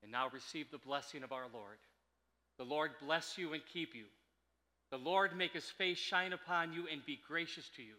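solemn blessing; benediction. Acted. Pronounces the closing benediction.

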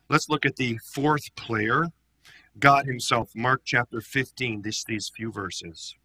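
usual speaking voice

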